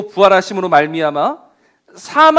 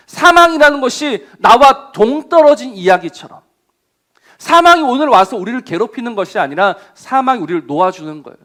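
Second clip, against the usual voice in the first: usual speaking voice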